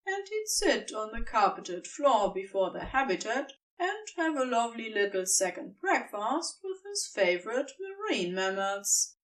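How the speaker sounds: ordinary delivery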